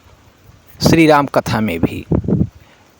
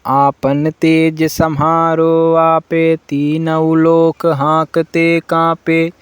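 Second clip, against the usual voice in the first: slow, louder, drawn-out chant of verses; devotional recitation; 4 semitones higher, 4 dB louder, much slower than usual. Acted. Devotee chanting Hanuman Chalisa for listeners, slow and full. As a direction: recite each line slowly like a temple chant.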